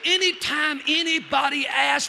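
loud, high, slow proclamation; full-force preaching. Real, not acted. Preacher addresses congregation about asking for prayer, full-force preaching. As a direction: proclaim it forcefully to the whole room.

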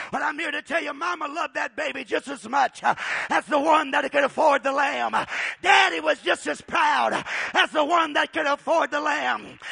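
loud, high preaching at full force. Acted. Preacher driving home the poor family's story, loud. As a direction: preach it out to the whole church.

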